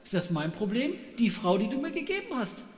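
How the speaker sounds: Adam blaming God and the woman, indignant and whiny; defensive excuse. Pretend. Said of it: "reagiert der Mensch so".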